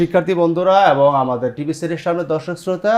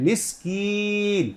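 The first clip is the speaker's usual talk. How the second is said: slow elongated stop; 5 semitones higher, 5 dB quieter, much slower than usual. Shown as a demonstration. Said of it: "ওয়াকফ করলে কয় আলিফ".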